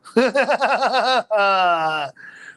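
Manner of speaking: long loud cackling laugh. Real, not acted. Streamer laughing at own joke, loud laugh.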